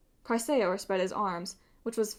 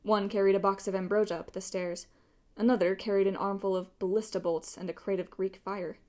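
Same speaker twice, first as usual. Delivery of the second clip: ordinary delivery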